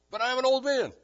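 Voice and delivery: loud, high-pitched mock objection; protesting. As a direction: argue back as the old man, pitched high. Acts the argument, Zechariah's side.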